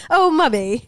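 laughing posh "mummy" up high; mock-posh British child, giggling. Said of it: "a British Australian".

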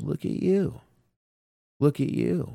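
high, playful ribbing; mock-proud look at you; teasing praise. Real, not acted. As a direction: tease him like you're impressed. Teasing cohost who watched show.

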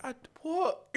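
shocked high what; confused what. Retold or imitated. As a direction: recall the confusion, pitch up high. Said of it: "And I'm like".

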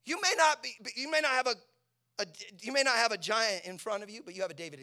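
loud, high preaching shout; high-pitched pulpit cry; full-force preaching. Real, not acted. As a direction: preach it at the top of your voice.

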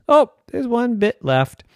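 mild surprise. Acted. Human finds last bit.

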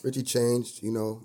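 hushed mock-earnest tribute; mock sincere. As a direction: deliver it like a solemn toast.

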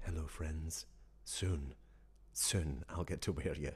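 put-on low, soft murmur to old friends; mock-tender longing. Man greeting his autumn jackets, low, soft mock tenderness. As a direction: play it hushed and low, a fond promise.

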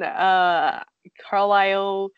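normal talking voice

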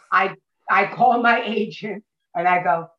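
usual speaking voice

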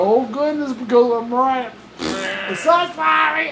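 high-pitched mock apology; sarcastic apology. Real, not acted. Podcaster claiming to be right, high and mockingly sorry. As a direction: gush a fake apology, breaking into laughter.

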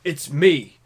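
put-on loud, emphatic accented politician; Bernie Sanders impression. Announcing himself as the senator, loud and emphatic. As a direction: bark each phrase with a pause between. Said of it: "I'll do a good Bernie Sanders".

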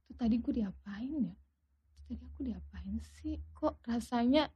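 very soft, puzzled self-questioning; hushed wondering to oneself; retold bewildered thought. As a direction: murmur it as a dazed inner question. Retold bewildered thought while sitting dazed.

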